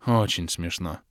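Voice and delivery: put-on girl grumbling at the hero's joke. A narrator's reading. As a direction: grumble it sourly. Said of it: "проворчала девушка".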